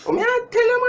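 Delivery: very high squeak of amazement; squealed exclamation. Real, not acted. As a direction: squeal it out in disbelief.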